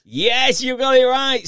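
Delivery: high, loud delighted cheer; excited. Real, not acted. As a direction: celebrate the right answer. Contestant answers correctly.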